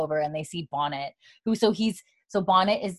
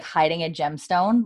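normal talking voice

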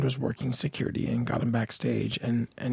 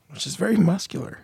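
ordinary delivery

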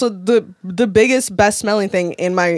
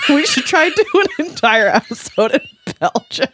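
high, loud laughing talk; laughing hard; 10 semitones higher, 4 dB louder than usual. Real, not acted. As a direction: talk through big laughter.

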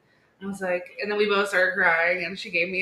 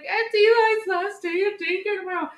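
high, louder, hammed-up crying voice; mock tearful wail; 12 semitones higher, 5 dB louder than usual. Mom retelling her sob to Corey about last daycare day, mock wailing. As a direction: wail it like a weepy overwrought parent.

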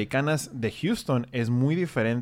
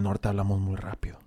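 dropped to a whisper; a whispered remark about fast northern talk; whispered aside; 5 semitones lower, 5 dB quieter than usual. Real, not acted. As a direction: confide it under the breath. Stressing northern fast speech.

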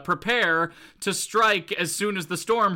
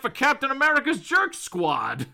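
raised, incredulous comic grumble; acted villain's complaint; 6 semitones higher, 5 dB louder than usual. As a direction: voice a put-out villain, pitched up. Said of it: "is just like".

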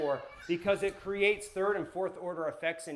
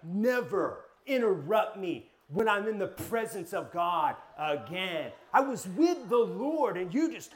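mock indignant rant, slow and higher; retold angry outburst; 3 semitones higher, 5 dB louder, slower than usual. Yelling at his roommate for interrupting prayer, mock indignant. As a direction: replay the self-righteous blow-up, dragging the words. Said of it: "I had this whole anger speech".

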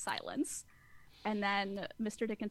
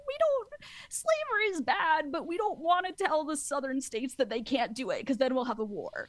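high-pitched, louder mimicry of people making excuses; mock protesting; 6 semitones higher, 3 dB louder than usual. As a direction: protest in a put-on higher voice.

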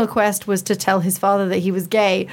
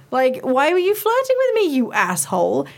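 mock outrage; 5 semitones higher than usual. Confronting Dorian after his quest.

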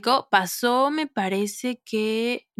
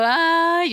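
mock whiny sigh; 8 semitones higher, 3 dB louder than usual. Teen whining to mother.

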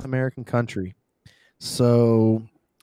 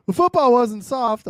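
mock tough shout; 10 semitones higher, 6 dB louder than usual. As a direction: bellow it like an old-school tough guy. Repeats phrase, second time louder.

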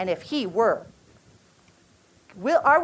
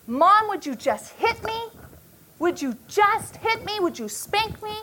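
put-on much higher, desperate child begging; imitating pleading child; 8 semitones higher than usual. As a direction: plead like the upset boy, voice up high. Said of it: "he just starts screaming and crying".

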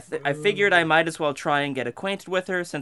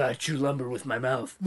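lightly put-on voice, a bit lower and softer; mock Frank voice; 3 semitones lower, 5 dB quieter than usual. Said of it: "That's what Frank says".

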